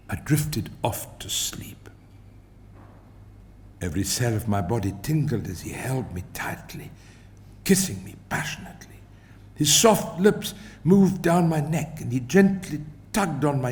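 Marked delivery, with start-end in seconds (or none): 0.1-9.0 whisper. A narrator's reading. Dreamy romance passage read softly.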